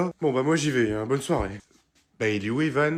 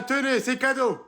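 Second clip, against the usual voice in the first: excited; 10 semitones higher, slower than usual. Acted. Shoving ice cream at teacher.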